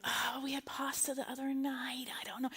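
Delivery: soft whispery waffling; hushed second thoughts. Retold or imitated. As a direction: back out of it under your breath. Acting out her doubts about Italian.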